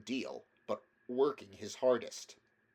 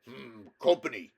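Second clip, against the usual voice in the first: gruff louder grunt of disdain; 8 dB louder than usual. A narrator's reading. Badger grunting at the picnic company. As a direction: grunt it, unimpressed. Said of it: "then grunted".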